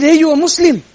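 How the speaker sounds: high, loud challenge; pointed questioning tone. Retold or imitated. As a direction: call it out high and loud, startling her. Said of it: "I said it in that tone".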